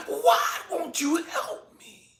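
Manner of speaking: high, drawn-out pleading; desperate plea to God. Retold or imitated. Man about to die begging God for help, desperate and high. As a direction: strain upward, slowing on each plea for help. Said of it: "having this conversation in my head with God".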